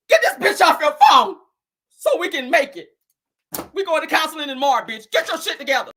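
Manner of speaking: wife ordering husband to drop the other woman; mock ultimatum. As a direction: tell him to fix it. Wife laying down the law.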